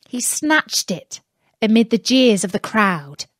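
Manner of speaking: usual speaking voice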